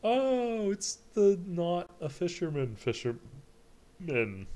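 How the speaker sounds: high, loud, slow excited recognition. Real, not acted. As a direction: squeal it with delight.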